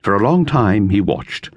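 usual speaking voice

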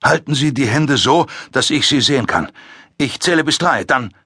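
usual speaking voice

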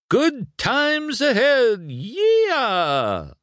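very high, slow, stretched-out whoop; excited cheer. Acted. Mac driving off with Lightning, excited cheer. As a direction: cheer with a long drawn-out finish. Truck cheers as trip begins.

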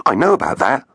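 put-on boy voice. Finn interjecting.

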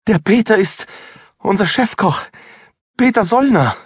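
high, breathless woman's voice; agitated stammer. A narrator's reading. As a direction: stammer it out, shaken. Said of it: "stammelte sie aufgeregt".

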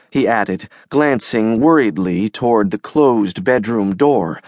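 ordinary delivery, nothing marked

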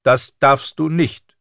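slow, high, loud refusal; strict governess laying down the rule; stern septa. A narrator's reading. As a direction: forbid it firmly, drawing the words out. Septa forbids girl to leave.

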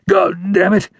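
high-pitched cry of pain and outrage. A narrator's reading. Wounded outlaw to the ranger who shot him, pained and outraged. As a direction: curse as if just shot. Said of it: "he said, pained and outraged".

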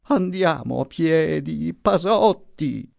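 put-on high, thin old voice, slow on the name; feeble moan. A narrator's reading. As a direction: whimper the plea. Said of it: "gemeva la voce flebile".

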